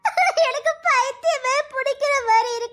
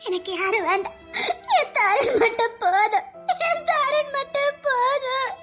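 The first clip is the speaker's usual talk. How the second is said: tearful lament. Acted. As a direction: wail and beg him to wake. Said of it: "இப்ப பைத்தியக்காரி மாதிரி புலம்பியதுக்கு". (அழுகையோடு மெதுவாக புலம்பல்)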